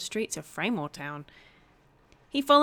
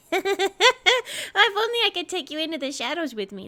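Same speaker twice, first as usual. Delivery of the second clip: higher, giggling through the words; 9 semitones higher, 3 dB louder than usual. Acted. Rye giggles, dreaming of sneaking with Lynn. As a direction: laugh mischievously as you speak. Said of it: "Rye lets out a wicked little giggle".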